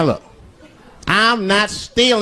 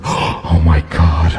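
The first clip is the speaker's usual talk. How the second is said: scared whisper; 12 semitones lower, 4 dB quieter than usual. Retold or imitated. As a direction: hiss it like terrified passengers.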